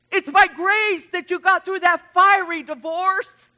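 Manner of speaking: loud, high, building preacher cadence; preaching at full force. Real, not acted. Pastor preaching grace to the congregation, building and loud. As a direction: hammer each line home like a revival preacher.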